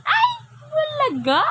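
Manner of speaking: high, loud playful peekaboo squeal; sudden sing-out surprise for a child. Pretend.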